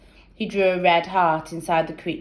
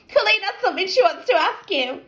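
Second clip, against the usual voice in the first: put-on girl voice; 13 semitones higher, faster than usual. A narrator's reading. Teasing friend.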